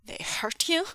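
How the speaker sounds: high, alarmed angry question; angry demand. Acted. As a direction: demand it with flaring anger. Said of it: "Wei Wuxian demanded, anger flaring".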